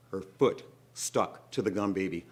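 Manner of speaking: ordinary delivery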